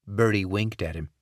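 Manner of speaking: plain reading voice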